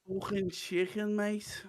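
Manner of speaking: slow, high mock Scouse; Scouse accent. Trying the Scouse trigger phrase, exaggerated accent. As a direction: stretch it out in broad Liverpool. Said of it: "if you ever need to go scouts".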